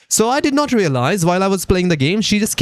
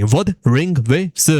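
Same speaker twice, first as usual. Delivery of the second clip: WUTHERING WAVES stretched out word by word; slowly. Retold or imitated. Gamer getting a child to repeat the game's name, slowly. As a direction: teach Wuthering Waves at a patient crawl. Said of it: "Say it with me slowly".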